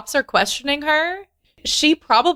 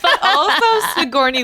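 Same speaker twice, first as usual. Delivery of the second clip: laughing; 8 semitones higher, faster than usual. Real, not acted. Cohost laughing at the flub.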